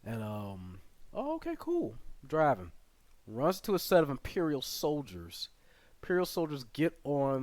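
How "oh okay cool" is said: much higher casual driver. Retold or imitated. The driver accepting their answer, high breezy voice.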